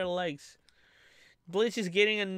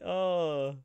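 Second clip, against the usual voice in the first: usual speaking voice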